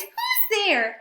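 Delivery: high startled squeak; mouse cries out. Acted. Mouse calling out to the glowing eyes, high and startled. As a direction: squeak out in alarm. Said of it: "Mouse cries".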